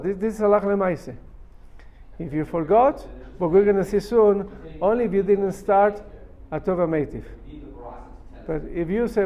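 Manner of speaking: ordinary delivery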